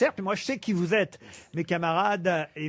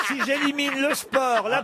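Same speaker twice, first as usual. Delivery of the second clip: laughing; 9 semitones higher than usual. Real, not acted. Host laughing while answering.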